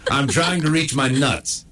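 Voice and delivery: usual speaking voice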